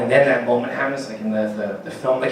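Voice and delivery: normal talking voice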